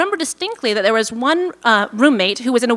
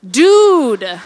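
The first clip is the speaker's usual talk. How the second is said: slow laid-back California surfer dude; drawn-out Californian surfer "dude"; Californian accent; 6 semitones higher, 3 dB louder, much slower than usual. Retold or imitated. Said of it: "with my best Californian accent".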